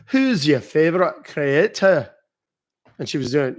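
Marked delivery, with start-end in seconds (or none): 0.1-2.1 slow, broad Scottish lilt; Scottish accent. Retold or imitated. Streamer imitating a girl from a post, Scottish accent. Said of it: "this Scottish girl talking about".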